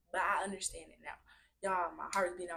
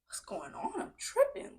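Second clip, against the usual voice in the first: retold alarm; 4 dB louder, slower than usual. Retelling alarmed inner thoughts.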